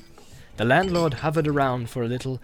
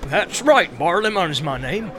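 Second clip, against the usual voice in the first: bustling innkeeper; 3 semitones higher than usual. Acted. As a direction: greet the guest heartily. Landlord introduces himself.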